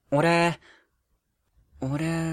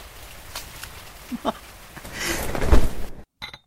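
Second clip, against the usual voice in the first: eerie high laugh; 14 semitones higher, 6 dB louder than usual. Acted.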